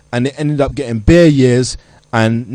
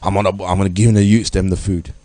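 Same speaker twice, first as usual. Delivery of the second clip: lower, softer self-satisfied boast; mocking boastful gangster; 8 semitones lower, 5 dB quieter than usual. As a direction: play the would-be boss full of himself. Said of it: "they were".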